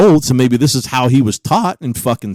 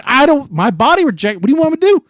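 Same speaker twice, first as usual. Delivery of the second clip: exasperated quote; 11 semitones higher, faster than usual. Retold or imitated. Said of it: "I'm like".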